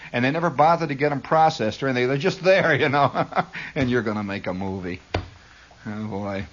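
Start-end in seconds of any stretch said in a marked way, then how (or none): none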